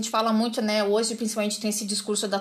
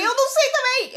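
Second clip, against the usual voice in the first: much higher mock-panicked protest; retold panic; 13 semitones higher, 4 dB louder than usual. Recalling her answer to the driving examiner, mock panicked. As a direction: squeal it in comic alarm. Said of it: "Eu fiquei apavorada".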